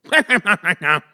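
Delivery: high, loud holler breaking into laughter; loud, laughing catchphrase yell; shouted catchphrase. Pretend. Shouted catchphrase for a fantasy pick.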